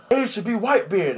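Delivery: high, loud mock-heroic cry; battle shout. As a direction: shout it as a retold battle line. Ace's cry before punching.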